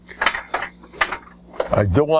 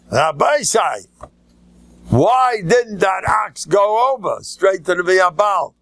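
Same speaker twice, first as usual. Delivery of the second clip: challenging shout; 8 semitones higher, slower than usual. Retold or imitated. Questioner shouts at the rabbi.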